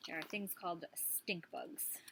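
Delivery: soft unvoiced whisper. Real, not acted. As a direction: breathe it as a little secret. Teacher whispers aside.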